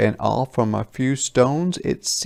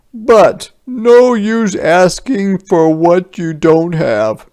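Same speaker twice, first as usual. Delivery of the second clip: higher, louder soldier with a mock sigh; 8 semitones higher, 9 dB louder than usual. A narrator's reading. Soldier pretending resignation to villagers, higher and louder. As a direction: feign regret. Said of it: "the soldiers sighed".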